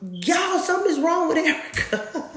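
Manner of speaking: high, laughing alarmed sister; a laughing imitation of a panicked sister. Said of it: "she's telling them".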